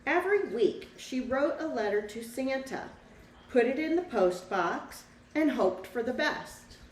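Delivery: lively storybook read-aloud for a class; reading to children. A narrator's reading. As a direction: tell the story to the children, bright and clear. Teacher reads picture book aloud.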